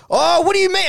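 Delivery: high, loud, incredulous whine; indignant protest. Acted. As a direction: cry it out as if wrongly accused. Acted protest in retold row.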